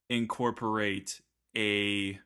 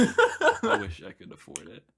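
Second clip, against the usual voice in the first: laughing; 8 semitones higher, 4 dB louder than usual. Real, not acted. Wistful joke, laughing.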